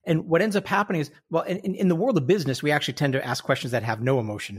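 ordinary delivery

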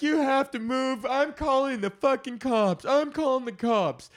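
high, loud and furious; angry lady yelling. Retold or imitated. Cyclist yelling at delivery guy, high and loud. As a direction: snap at the driver like an outraged cyclist. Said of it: "She's like".

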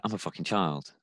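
dropped low and soft, a stunned admission to himself; quiet realization. Retold or imitated. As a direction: say it quietly, as if it just hit you.

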